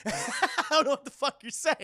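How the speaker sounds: high, loud, real laughter through the words; helpless laughing delivery. Real, not acted. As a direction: crack up while talking. Cracks up repeating the line.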